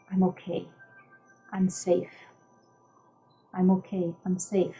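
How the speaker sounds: calm your own body, phrase by phrase; self-soothing mantra. Shown as a demonstration. Coach modelling words to the nervous system, soothing and quiet. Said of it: "You tell your body, your nervous system".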